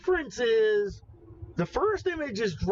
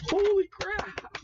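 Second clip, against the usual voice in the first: amazed again; 12 semitones higher, slower than usual. Real, not acted. Still amazed.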